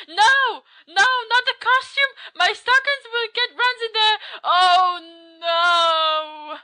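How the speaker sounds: high, loud melodramatic wailing; hammy distress. Acted. Friend attacked with pillows begs for costume, melodramatic high wail. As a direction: shriek in protest, stretching the final cry.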